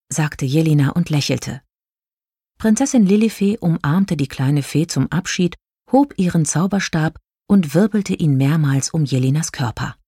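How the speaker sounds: usual speaking voice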